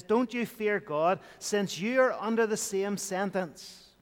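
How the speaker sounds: ordinary talk, nothing marked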